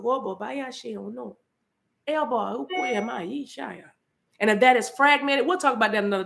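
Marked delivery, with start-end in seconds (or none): 0.0-3.8 soft, fading glossolalia; speaking in tongues. Real, not acted. Preacher praying in tongues before the congregation, fading softly. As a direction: pray in tongues, trailing off.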